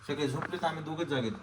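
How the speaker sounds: hushed, everyone asleep; quiet at night. Real, not acted. सगळे झोपलेत.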